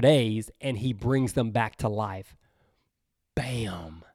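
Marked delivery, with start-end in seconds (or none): 3.3-4.0 low emphatic. Real, not acted. Dramatic low punchline.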